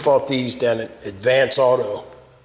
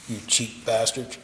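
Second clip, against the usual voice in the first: much softer, low grumbled insult; muttered curse; 6 semitones lower, 9 dB quieter than usual. Real, not acted. Man cursing the company after opening the package, muttered. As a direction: grumble it under your breath.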